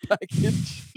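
giggling breaking into the words; laughing through a quote. Retold or imitated. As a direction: quote it while cracking up. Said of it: "and I say".

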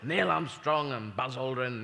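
ordinary delivery

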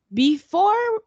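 high, loud eager start; jumping back in eagerly; excited burst. Real, not acted. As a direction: jump in with excitement. Sudden excited restart.

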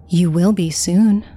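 slow, lightly voiced ghostly threat; slow, eerie mirror spirit; smirking phantom. A narrator's reading. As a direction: draw it out slowly as an ominous phantom. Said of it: "But then she continued".

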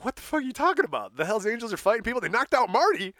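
high, agitated mock report; alarmed retelling. Drummer telling bands about the fight, high and agitated.

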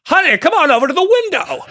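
put-on high-pitched old-movie leading man; mock old Hollywood accent, calling out; hammed-up mid-century film star; Jimmy Stewart. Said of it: "He was just like".

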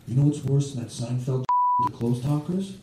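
suddenly serious. Retold or imitated. Would-be robber pitching a joke to the comic, suddenly serious. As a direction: confide it as if it were weighty. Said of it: "he gets serious all of a sudden".

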